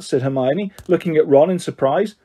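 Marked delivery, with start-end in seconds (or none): none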